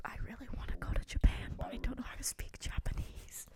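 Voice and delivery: hushed unvoiced whisper. Real, not acted. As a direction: whisper it under your breath. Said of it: "You guys are all whispering".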